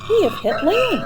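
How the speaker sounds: high, bright-eyed discovery; excited realization. Acted. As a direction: gasp it out like good news. Noah realizing the ark landed.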